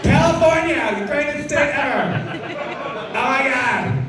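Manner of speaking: high-pitched mock Californian gusher; American accent impression. Comedian mimics a California lady yelling out, in exaggerated American accent. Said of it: "we do impressions of American accents".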